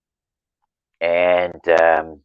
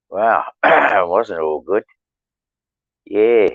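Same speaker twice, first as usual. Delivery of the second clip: normal talking voice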